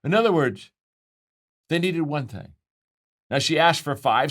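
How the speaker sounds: ordinary delivery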